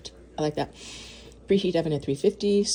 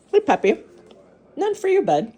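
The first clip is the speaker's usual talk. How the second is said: high, louder pet-talk voice; talking to puppy; 10 semitones higher, 5 dB louder, faster than usual. Real, not acted. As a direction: tease the dog in a high singsong. Talking to the dog.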